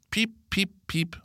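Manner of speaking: very high cheeping call; calling like a bird. A narrator's reading. Pip calling from the tree to the boys, high cheeps. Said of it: "råber Pip".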